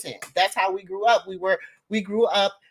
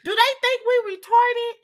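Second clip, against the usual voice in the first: incredulous cry; 12 semitones higher than usual. Real, not acted. Outraged rant about a cartoon.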